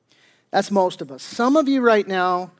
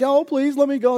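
higher-pitched acted plea; mock begging; 7 semitones higher, 3 dB louder than usual. Debtor begging the king to let him go, mock pleading. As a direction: plead like a debtor, lightly hammed up. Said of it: "the guy begged".

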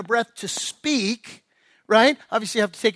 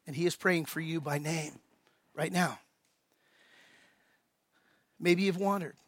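tender appeal; 4 semitones lower, 8 dB quieter than usual. Real, not acted.

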